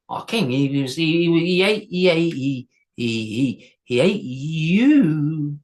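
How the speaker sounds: halting, slow stammer; a lightly voiced troll, stumbling over words. A narrator's reading. Troll stammering that his king ate the hero. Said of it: "troll stammers".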